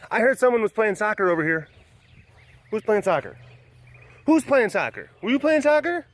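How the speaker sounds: high, loud playful quizzing; excited pet talk. Real, not acted. Asking the pets who was playing soccer, excited pet talk. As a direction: call it out high and eager.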